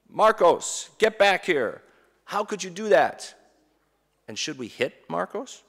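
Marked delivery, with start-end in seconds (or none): 0.1-3.3 put-on higher, louder yell at the child; a parent half-yelling after the thief; mock parent scream. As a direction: shout like a parent losing it. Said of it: "We scream. And what do we scream?".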